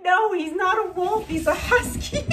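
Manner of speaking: high, squeaky correction breaking into laughter; acted protest. Owner corrects woman who took husky for wolf, protesting. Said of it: "And I'm like".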